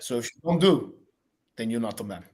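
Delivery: low, quiet mock-macho voice; lightly voiced Turbo lecturing his son; a hint of Turbo laying down the law to his kid, low and soft; Turbo impression. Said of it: "Turbo's teachable moments would go a little something like this".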